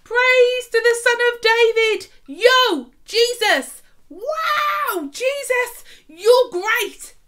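high, loud, joyful shouting praise; excited children's cheering. Acted. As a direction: cheer it out like kids in the temple. Crowd of children praising Jesus.

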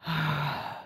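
long, heavy exhale; weary sigh. Real, not acted. Player worn out after ranting about the game, weary sigh. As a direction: let out a drawn-out sigh.

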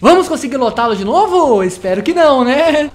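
loud, high cheerful intro; YouTuber intro. Acted. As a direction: hype up the viewers.

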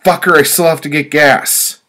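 loud, high-pitched full shout; angry shout. A narrator's reading. Customer cursing the driver waiting behind at the pump, shouting. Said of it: "I shouted".

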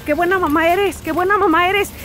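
high, sing-song compliment from strangers; mock compliment. Strangers outside Goodwill flattering her dress, high and sing-song. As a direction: play up the men's flattery.

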